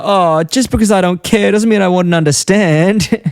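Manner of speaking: high, slow mock-indignant dad; Homer. Cartoon dad answering his daughter, mock-indignant protest. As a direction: protest it slowly, hammed up and high. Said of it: "Homer goes, says to Lisa".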